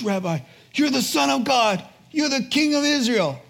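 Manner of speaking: high, loud, awed cry of faith; awed exclamation. Acted. Nathaniel proclaims Jesus the Son of God in awe.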